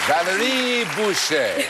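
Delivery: slow, drawn-out host announcement of the name; game show introduction. Acted. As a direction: present her name like a TV host. Host presents contestant.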